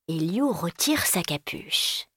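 usual speaking voice

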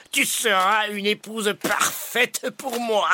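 slow, high sneering villain voice; sneering panto villain. Acted. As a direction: leer at her like a pantomime baddie. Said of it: "ricane-t-il".

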